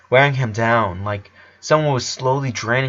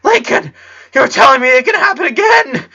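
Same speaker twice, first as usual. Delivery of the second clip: much higher, louder, near tears; terrified boy complaining through tears; panicked complaint; 14 semitones higher, 7 dB louder than usual. A narrator's reading. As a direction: cry out in panic. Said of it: "Clyde complained".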